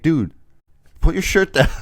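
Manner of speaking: high mock scold, laughing; a hint of a bossy girl. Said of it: "she goes like".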